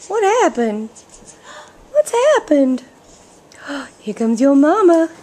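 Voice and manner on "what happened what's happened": ordinary talk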